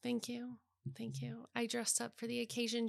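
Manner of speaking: soft, demure thanks; coy thank you. Acted. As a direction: accept the compliment bashfully. Coy reply to compliment.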